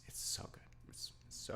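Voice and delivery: breathy hushed whisper of delight. Real, not acted. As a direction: whisper it like a secret treat. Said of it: "whispered praise".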